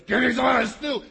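hammed-up hungry Esau shouting for stew; gruff demand. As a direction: bark it like a starving big brute. Big hairy Esau demanding stew.

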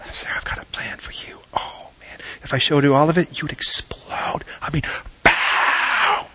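whispered, secretive voice of God; hushed God voice. Retold or imitated. God whispering his plan to Sarah. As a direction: lean in and whisper God's promise.

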